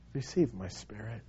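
soft, low, yielding voice; surrender. Acted. Preacher voicing dying Stephen's surrender to Jesus, hushed and low. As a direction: let it sink down softly in surrender. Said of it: "It's a word of surrender".

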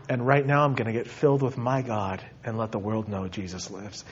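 low, soft voice fading to a whisper; hushed devotion. Real, not acted. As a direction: let the resolve sink gently into breath. Preacher settles into closing devotion.

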